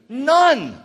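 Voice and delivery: usual speaking voice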